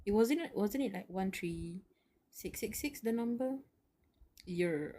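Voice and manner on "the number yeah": usual speaking voice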